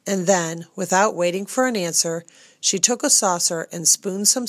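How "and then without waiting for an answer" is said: ordinary delivery, nothing marked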